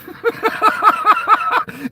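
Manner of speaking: shrill helpless laugh; high disbelieving laugh. Real, not acted. Streamer laughing in despair at the bet. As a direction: laugh so you don't cry. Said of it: "I'm laughing so I don't cry".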